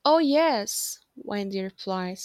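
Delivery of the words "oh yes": higher, louder cheerful reply; bright eager yes. Acted. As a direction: reassure them with a bright lift. Wendy answers the boys brightly.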